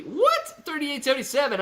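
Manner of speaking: sudden high squeak of disbelief; can't believe the number; shocked high "What?"; surprised. Real, not acted. Disbelief at the closing price.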